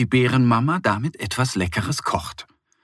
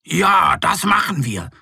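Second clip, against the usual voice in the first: higher, louder cheer; eager agreement; 7 semitones higher, 4 dB louder than usual. Acted. All the animals agree to carry the boar, eager. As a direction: say yes with gusto.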